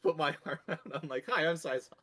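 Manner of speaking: words pushed out through laughter; giggling hard while talking; laughing fit. Real, not acted. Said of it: "Sorry, I'm laughing so hard".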